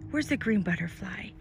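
ordinary delivery

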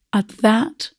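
plain reading voice

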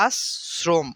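আশ্রম as "as-srom", no final vowel; correct dental-s pronunciation. Shown as a demonstration. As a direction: split আশ্রম with a clear s and closed m. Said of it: "এই জন্য এটার উচ্চারণ হবে". (আশ্রম উচ্চারণ "আস্-স্রোম্")

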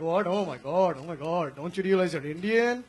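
astonished mock Indian accent; fake Indian accent. Crew scolding the comic in a mock Indian accent. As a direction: exclaim in a put-on Indian accent, slowing at the end. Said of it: "that fake accent part".

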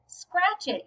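high-pitched yell without the volume; narrated yell, held back; mild mock yell. A narrator's reading. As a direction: pitch it up like a child calling back. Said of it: "yelled Avery as he sailed back".

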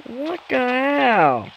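drawn-out, high exclamation; slow, incredulous high cry; startled outburst. Real, not acted. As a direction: stretch it out high, then let it fall. Startled reaction during the game.

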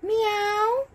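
high run of meows; playful human meowing; cat imitation. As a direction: mimic a kitty in a high voice.

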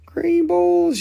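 green balls squeaked way up high; a high silly squeal of the words; high squeak repeat. Acted. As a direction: squeal it like a cartoon. Repeats with joke.

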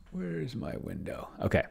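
ordinary talk, nothing marked

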